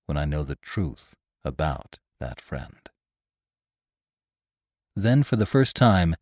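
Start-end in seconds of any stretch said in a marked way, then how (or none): 0.1-2.9 low, soft, measured tension; hushed intensity with weighted pauses; tense voice. A narrator's reading. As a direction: press quietly and gravely. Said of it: "the same tense voice".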